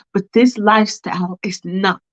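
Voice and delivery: normal talking voice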